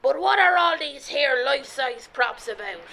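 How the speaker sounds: put-on pirate queen accent; hammed-up storybook pirate accent. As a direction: question them in pirate accent. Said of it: "You have to do the accent".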